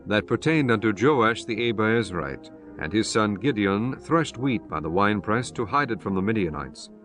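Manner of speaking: ordinary talk, nothing marked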